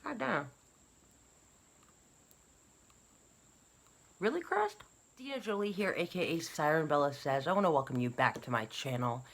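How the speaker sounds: talking with whitening strips in; words around teeth strips. Real, not acted. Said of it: "Still have my Crest whitening strips in".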